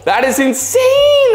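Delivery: high, drawn-out cry of amazement on "insane"; amazed. Real, not acted. Host marvelling at a bright purple fish, amazed. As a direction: stretch it out and shoot up high, wowed.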